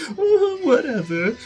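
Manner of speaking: high bored drawl, slow; dismissive brat. Retold or imitated. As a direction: brush him off. Helmeppo dismissing Zoro.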